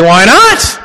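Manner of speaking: high, loud mock-surprised why not; teacher playing dumb with students; mock innocent. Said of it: "I'm like".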